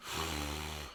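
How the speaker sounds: long, soft exhaled sigh; weary sigh. Real, not acted. Commentator reacting to the president's words, weary sigh. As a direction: let out a quiet, heavy breath. Sighs at the news.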